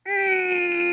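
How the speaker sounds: long, high held hum; embarrassed whimper. Retold or imitated. Passenger stared at by everyone, long high whimpering hum. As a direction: draw out a thin high mm. Passengers staring as she leaves.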